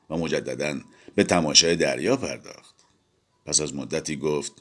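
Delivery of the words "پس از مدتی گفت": ordinary delivery, nothing marked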